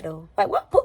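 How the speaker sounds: ordinary talk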